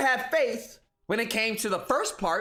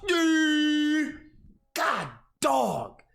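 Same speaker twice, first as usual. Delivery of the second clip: higher, dopey Xbox fanboy; dim fanboy voice; 4 semitones higher, much slower than usual. Acted. Xbox fanboy bragging about Bethesda in a dopey voice. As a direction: play the dopey console warrior. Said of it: "this whole Tard voice thing he's doing".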